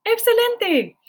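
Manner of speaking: high, loud "Excelente"; excited praise. Real, not acted. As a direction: cheer the class on. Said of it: "praising students".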